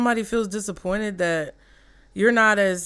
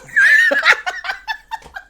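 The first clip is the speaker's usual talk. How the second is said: real high-pitched cackle; high cackling laugh; 7 dB louder than usual. Real, not acted. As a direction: burst into a squealing laugh. Laughing at own bit.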